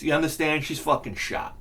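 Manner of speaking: ordinary talk, nothing marked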